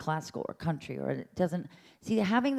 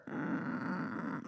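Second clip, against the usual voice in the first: breathy noise; 10 dB quieter than usual. Shown as a demonstration. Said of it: "and the fur".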